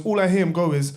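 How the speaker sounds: ordinary talk, nothing marked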